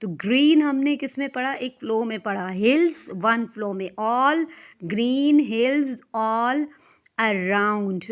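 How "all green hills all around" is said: slow, spaced GREEN, HILLS, ALL, A-ROUND; stretched "green hills all around" for syllable counting. Shown as a demonstration. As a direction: give each word of "green hills all around" its own beat. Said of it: "इसमें कितने हो गए?".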